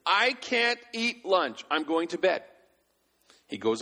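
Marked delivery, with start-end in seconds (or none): 0.0-2.4 put-on high, slow crying-child voice; imitating a wailing three-year-old; mock whiny child. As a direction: play the sulking toddler. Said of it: "starts crying hysterically".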